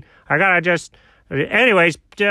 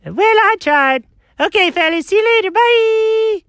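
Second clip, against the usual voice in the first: very high cartoon voice with a long sung-out goodbye; cheery bailing-out mediator; 3 dB louder than usual. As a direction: give up and flee with a squeaky farewell. Failed mediator makes a quick exit.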